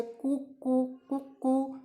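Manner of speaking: soft, high cooing; repeated soft pigeon coos. Shown as a demonstration. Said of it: "pigeon कैसे बोलता है".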